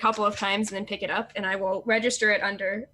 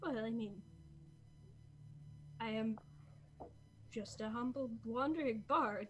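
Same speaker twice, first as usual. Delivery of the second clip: humble murmur; 14 dB quieter than usual. Acted. Humble bard speaks.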